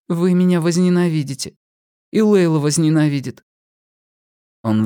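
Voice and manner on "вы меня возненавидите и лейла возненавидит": put-on higher, hesitant young woman. A narrator's reading. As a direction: confess timidly as a young woman. Pip voiced by narrator.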